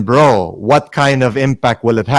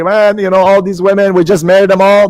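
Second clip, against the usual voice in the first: mocking careless brother; 5 semitones higher than usual. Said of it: "I'm not saying close your eyes and say".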